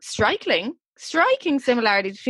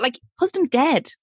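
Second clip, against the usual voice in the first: ordinary talk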